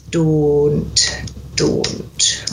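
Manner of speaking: "don't" slow with a long pure O; Scottish O sound, drawn out. Shown as a demonstration. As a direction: stretch the unglided O in "don't". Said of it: "we need to get that O sound".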